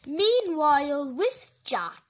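slow announced scene change; announcer voice. Pretend. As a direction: announce it like a title card.

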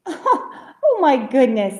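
loud, amused exclamation through a laugh. A narrator's reading. Bear is tickled by little bird's idea, laughing. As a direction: chuckle out the words slowly.

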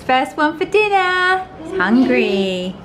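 loud, slow, drawn-out announcement; excited announcing. Real, not acted. Telling viewers the dog comes for dinner, loud and slow. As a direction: boom it out as he arrives.

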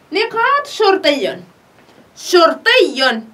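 شرطي drawn out very slowly, syllable by syllable; slow dictation. Shown as a demonstration. Teacher dictating the word for pupils, very slowly. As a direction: stretch شرطي out for the class to hear. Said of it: "لقد قلنا شرطي".

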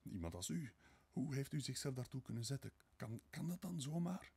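bewildered questions climbing high; stunned, faltering questioning; dazed disbelief. A narrator's reading. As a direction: stammer it, not in your senses, pitch rising. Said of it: "nog altijd niet bij zinnen".

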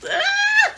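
high loud yell before the bite; bracing yell. Real, not acted. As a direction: yell to psych herself up. Bracing to eat.

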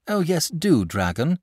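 bursting with glee at the idea; delighted. A narrator's reading. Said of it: "said the boy delightedly".

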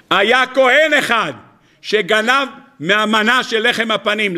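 usual speaking voice

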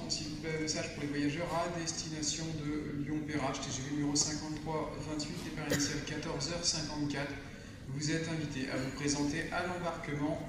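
station staff PA announcement; steady announcer over loudspeakers; over the intercom. Real, not acted. Staff announcement to Lyon passengers. (annonce en gare au micro)

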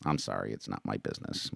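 low, soft, backing away; subdued apology. Acted. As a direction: apologize quietly and drop the subject.

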